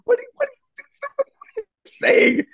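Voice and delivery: high breathless whispered disbelief; incredulous whisper. Retold or imitated. Incredulous whispered retold question.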